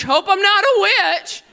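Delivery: put-on worried medieval teen. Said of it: "You're like".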